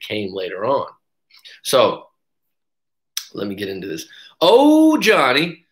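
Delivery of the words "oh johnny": delighted surprise. Real, not acted. Happy surprise at chat names.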